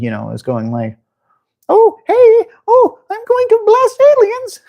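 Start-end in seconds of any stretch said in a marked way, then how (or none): 1.7-4.6 very high, exaggerated falsetto diva voice; Miss Piggy. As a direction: squeal it out in a high puppet falsetto. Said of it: "Miss Piggy has her guns and".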